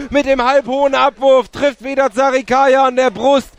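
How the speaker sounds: usual speaking voice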